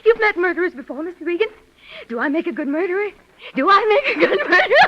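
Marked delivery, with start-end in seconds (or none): 3.5-4.9 higher and louder, laughing through the words; unhinged laughing question. Acted. Repeats it, breaking into laughter.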